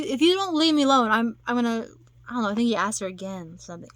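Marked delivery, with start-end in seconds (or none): 0.0-1.9 loud, high retold outburst; mock snap, pitched up and loud; snapping. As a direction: act out someone losing patience. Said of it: "then she snaps".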